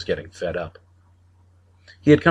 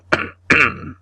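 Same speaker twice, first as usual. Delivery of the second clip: throat clearing; 7 semitones higher than usual. A narrator's reading.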